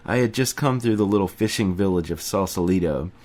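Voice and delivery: ordinary delivery, nothing marked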